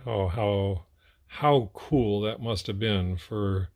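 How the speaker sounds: usual speaking voice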